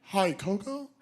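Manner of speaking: mock-careful, halting delivery; hesitant bad-news breaker. Keeper gingerly tells Coco bad news, hesitant. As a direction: tiptoe into it with long pauses.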